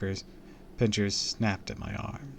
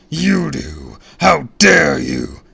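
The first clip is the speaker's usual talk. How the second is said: high, loud, slow villain boom; 6 semitones higher, 15 dB louder, much slower than usual. A narrator's reading. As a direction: boom it like a cartoon brute. Villain continues booming.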